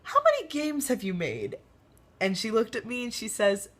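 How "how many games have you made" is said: mock-innocent question, pitched up; curious question. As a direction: ask sweetly as if just curious. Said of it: "I'm like".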